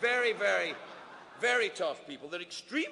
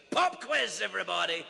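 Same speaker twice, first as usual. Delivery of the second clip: barked out louder and higher like an agent; a playful officer's shout, louder and higher; mock raid shout; 4 semitones higher, 6 dB louder than usual. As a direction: yell it like an immigration officer springing a surprise. Immigration agents raiding the room.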